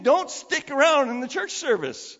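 high, loud preacher's command; forceful, urgent paraphrase of scripture; urgency. Acted. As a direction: press them to act this instant. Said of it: "There's this urgency about it".

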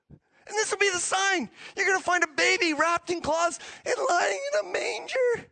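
very high, overjoyed mock announcement; hammed-up amazement, climbing high; hilarious excited angel. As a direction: squeal the big news like a giddy angel. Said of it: "they're hilarious".

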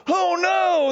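high, loud cry of alarm; mock panic. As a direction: imitate a crowd suddenly alarmed. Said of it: "they're panicking".

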